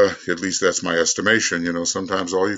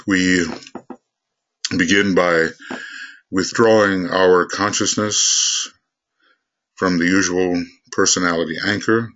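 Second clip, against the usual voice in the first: measured meditation leading with long pauses; guided meditation; 2 semitones lower than usual. Real, not acted. Teacher leading a group to withdraw their consciousness, guided meditation. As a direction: guide the group inward, phrase by unhurried phrase.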